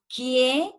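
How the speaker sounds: "Quie" as a separate syllable, rising at the end. Shown as a demonstration. Teacher splitting a word for the class, "Quie" as one rising syllable. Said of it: "la separamos, decimos".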